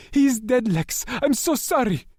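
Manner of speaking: high, loud grieving shout; anguished shout. A narrator's reading. Asher shouting the news to struggling Lex, high and loud. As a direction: force the truth on him through grief. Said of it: "Asher was shouting".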